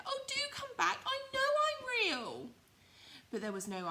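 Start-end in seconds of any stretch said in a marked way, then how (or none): 0.1-2.5 very high, loud little rabbit call; squeaky loud pleading rabbit call. Acted. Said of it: "called Little Rabbit".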